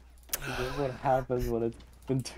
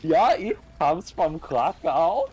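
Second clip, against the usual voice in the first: slow mock German-Polish accent; fake Polish accent; 5 semitones higher, 5 dB louder, slower than usual. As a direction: ham up a broken accent. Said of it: "That's my, uh, Polish accent".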